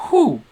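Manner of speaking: very high, loud swooning "whew"; smitten whew. Acted. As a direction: whoop out a lovestruck whew. Padme's smitten inner thought.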